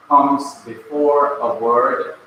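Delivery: usual speaking voice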